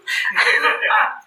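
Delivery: high, breathy burst of laughter; real, high-pitched laughing fit; hearty laugh. Real, not acted. Laughs at own joke.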